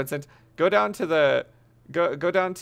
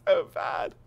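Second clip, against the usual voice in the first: laughing; 13 semitones higher, 9 dB louder, much slower than usual. Real, not acted. Laughing at his own line.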